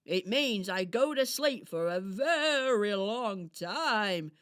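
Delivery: slow, loud storybook hedgehog; friendly hedgehog character. Acted. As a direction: stretch the words out as a kindly hedgehog. Voices Harris the hedgehog.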